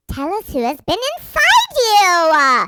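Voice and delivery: loud, high, drawn-out mock interrogation; hammed-up demand. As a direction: wail the demand slowly and theatrically.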